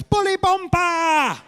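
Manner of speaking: put-on high, slow, shouted toddler cry; imitated small boy's gleeful yell; son's battle cry. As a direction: holler it and hold the last syllable. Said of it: "He just stands there and goes".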